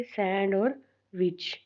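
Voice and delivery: Sand और विच slowly, with a pause before विच; separated syllables. Shown as a demonstration. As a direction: set sand and विच clearly apart. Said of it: "sandwich को अलग करना है".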